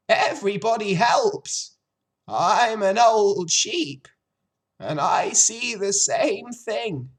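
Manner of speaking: put-on loud, high old sheep spelling out doom. A narrator's reading. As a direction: relish the grim news as the old sheep.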